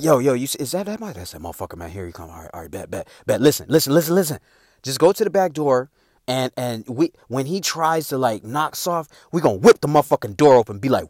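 low, soft excited murmur; hushed plotting. Retold or imitated. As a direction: scheme quietly by the window. Brothers plotting in hushed voices.

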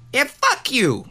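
a half-hearted Pesci "fuck you"; Joe Pesci voice. Shown as a demonstration. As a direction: spit "fuck you" out crisp like a wiseguy. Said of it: "I wish I could speak like Joe Pesci".

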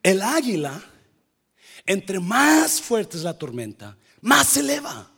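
fervent pulpit proclamation; preaching at full force. Acted. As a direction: lift the congregation with it. Preaching builds on eagle point.

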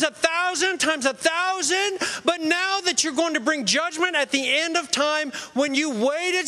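high, mock-indignant whine at God; whiny complaint. Believer protesting to God about judgment, high mock whine. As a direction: whine like someone who thinks they've been wronged.

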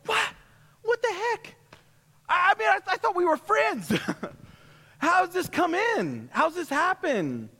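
shocked disbelief. Retold or imitated. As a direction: reel from betrayal by a friend. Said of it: "you're like".

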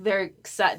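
usual speaking voice

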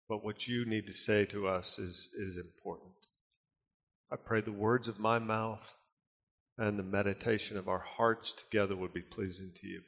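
hushed, reverent prayer voice. Acted. Pastor praying over the congregation, hushed and reverent. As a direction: pray softly before the message. Opening prayer before sermon.

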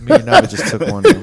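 a guilty giggle through the words; laughing. Real, not acted. Owning up to the pizza while laughing.

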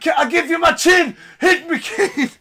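hammed-up goading; mock Austin dare. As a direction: offer the chin, yelling. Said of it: "He's like".

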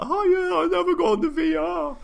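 overexcited squeal. Retold or imitated. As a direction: squeal it like an eager kid. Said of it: "just like".

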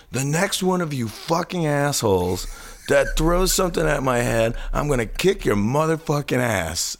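high, threatening mock yell; imitated yelling. Friend turns on the packed theater audience, imitated yelling. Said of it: "he yells, he goes".

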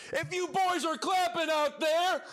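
high, slow comedy-club yell; pretending not to hear. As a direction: bellow it like a comic asking the crowd to speak up. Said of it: "my impression of a guy that can't hear".

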